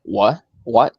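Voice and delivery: "What? What?" said as a questioning demo of the syllable wa. Shown as a demonstration. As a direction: ask "What? What?" to show how the wa sounds. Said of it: "And then wa is like".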